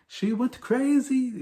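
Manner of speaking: the hotel waiter's line, high and slow; Manuel's voice. Retold or imitated. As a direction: say "She went crazy" slowly and high, as the comic waiter. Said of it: "the way Manuel says that".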